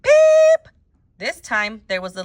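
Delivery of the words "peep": piercing high, loud chirp; louder blackbird peep. A narrator's reading. Boy chirping louder during silent reading, piercing peep. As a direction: peep loudly like a bird. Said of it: "Nick did it again, louder".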